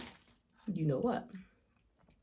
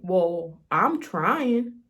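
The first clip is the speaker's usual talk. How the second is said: emotional outburst; 7 dB louder, much slower than usual. Real, not acted. To viewers about family pressure, a near-breaking cry. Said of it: "Sorry, y'all".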